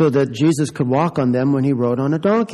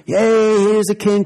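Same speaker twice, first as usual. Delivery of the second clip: cheering crowd; 6 semitones higher, slower than usual. A narrator's reading. The crowd greeting Jesus, cheering excitedly. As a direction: hail the king with joy.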